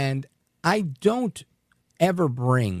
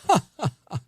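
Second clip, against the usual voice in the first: a short, higher mocking "ha ha ha"; mocking scoff laugh; 8 semitones higher, faster than usual. A handyman's politics get a deep mocking scoff. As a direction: scoff at him, head thrown back. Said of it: "It was a scoff, a deep scoff".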